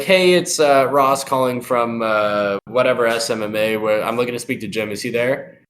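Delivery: ordinary delivery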